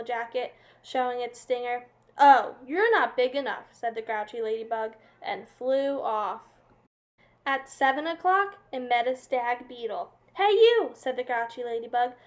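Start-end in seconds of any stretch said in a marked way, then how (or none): 2.2-3.7 loud scoffing dismissal. A narrator's reading. Grouchy ladybug dismisses. 10.4-10.9 loud, high call of challenge. A narrator's reading. Grouchy ladybug picks a fight.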